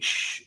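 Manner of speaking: soft hiss of escaping air; quiet leaking "shh"; imitated slow leak. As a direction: let a little air seep out.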